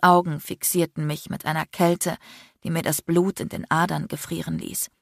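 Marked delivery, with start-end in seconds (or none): none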